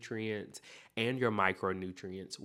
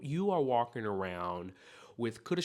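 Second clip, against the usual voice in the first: ordinary talk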